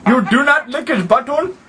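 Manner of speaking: put-on Arabian accent, lively and insistent; hammed-up Arabian-accented prank voice. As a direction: play an indignant caller with a thick Arabian accent.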